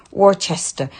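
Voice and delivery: "Worcester" said as WOR-chester; mispronounced Worcester. Real, not acted. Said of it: "I'm really rubbish at saying that".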